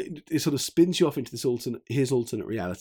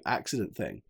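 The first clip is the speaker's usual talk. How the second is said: usual speaking voice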